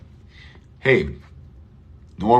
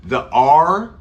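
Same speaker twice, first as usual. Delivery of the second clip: "the R" louder and higher, pointed out; stressed "the R"; 5 semitones higher, 6 dB louder than usual. Shown as a demonstration. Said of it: "we don't do".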